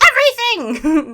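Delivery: high, loud vampire outburst; hammed-up exultant vampire cry; exclaiming vampire. A narrator's reading. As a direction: burst out grandly as a vampire. Vampire answering demand with exclamation.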